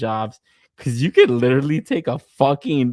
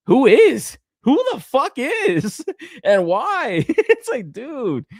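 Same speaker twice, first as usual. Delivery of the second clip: much higher and louder, slow disbelief; incredulous outburst; 11 semitones higher, 4 dB louder, slower than usual. Real, not acted. Streamer ranting to chat about the toy, incredulous. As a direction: throw the question at chat in disbelief.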